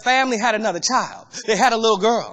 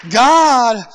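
loud cry; 5 dB louder than usual. Real, not acted. Preaching cry.

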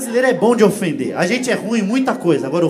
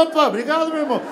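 cheerful thanks; 6 semitones higher, faster than usual. Retold or imitated.

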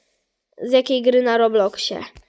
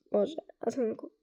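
hoarse after coughing; 10 dB quieter, faster than usual. Real, not acted.

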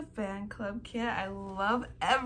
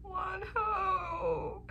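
breathless swoon; 10 semitones higher than usual. Real, not acted. Gasp as idol smiles.

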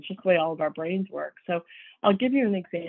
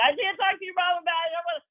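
much higher, frantic son voice; upset whining son; 11 semitones higher, faster than usual. Retold or imitated. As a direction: mimic a son losing it, voice shooting up. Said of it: "he'd become completely emotionally dysregulated".

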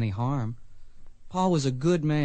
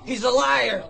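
furious accusation; 11 semitones higher than usual. Acted. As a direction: hurl it at the witness. Boy accuses witness in court.

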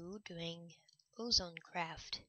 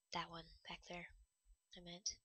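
whisper; 11 dB quieter than usual. Real, not acted. Whispered aside.

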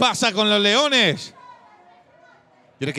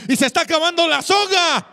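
excited commentary; 8 semitones higher than usual. Real, not acted. Live race call, rope running out.

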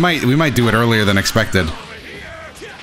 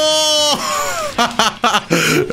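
high triumphant laughter; long high laugh; 12 semitones higher than usual. Real, not acted. Gamer laughing in triumph after the comeback. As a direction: laugh out the win.

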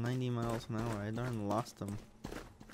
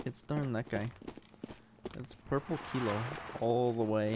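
ordinary delivery

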